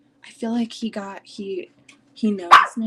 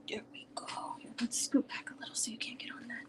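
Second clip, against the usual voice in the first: whisper; 3 semitones higher, 13 dB quieter than usual. Real, not acted. Settling the dog on lap.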